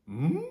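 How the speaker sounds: loud hum sliding up high; questioning cartoon-dog hum; confused Scooby-Doo hum. Teacher replays Scooby-Doo's sound, confused rising hum. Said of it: "Scooby-Doo".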